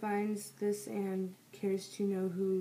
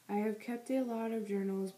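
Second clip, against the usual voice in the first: ordinary delivery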